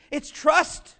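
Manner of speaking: ordinary talk, nothing marked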